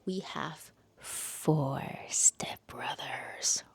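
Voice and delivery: slow breathy teaser whisper. Pretend. Reviewer teasing viewers with the book's premise, slow whisper. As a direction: hush it like a secret.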